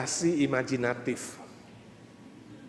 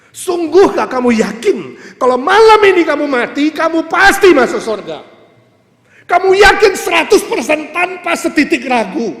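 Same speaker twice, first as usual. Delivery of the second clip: loud, high, urgent questioning; shouted sermon challenge; full-force preaching; 10 semitones higher, 13 dB louder than usual. Real, not acted.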